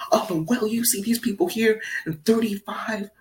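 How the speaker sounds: high, pushy mock voice; heated argument. Afro guy arguing votes with Steve, high pushy mock. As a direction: lecture him in a squeaky, heated voice. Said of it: "He was going".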